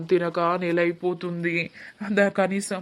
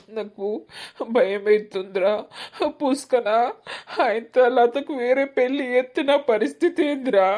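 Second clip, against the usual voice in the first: higher, louder tearful cry; 6 semitones higher, 4 dB louder than usual. Acted. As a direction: wail out the fear of losing her. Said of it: "అయ్యో, ఏడవకురా". (ఏడుస్తూ ఎక్కువ స్వరంలో)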